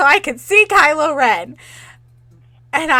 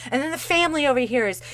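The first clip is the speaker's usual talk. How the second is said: ordinary talk, nothing marked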